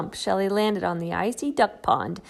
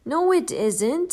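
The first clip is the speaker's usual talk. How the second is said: a little cross; 6 semitones higher, 5 dB louder than usual. Acted. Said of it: "feeling a little cross".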